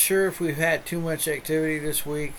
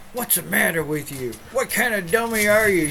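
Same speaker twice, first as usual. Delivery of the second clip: louder, higher mock scolding; mom scolding; 6 semitones higher, 4 dB louder than usual. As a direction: nag the dog like an annoyed mom. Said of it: "She would go".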